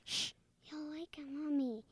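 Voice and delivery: hushed and quick, so nobody wakes. Acted. Karen hushes Ned so Mommy won't wake, hushed. Child hushes so mother sleeps.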